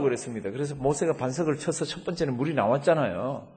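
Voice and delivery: ordinary talk, nothing marked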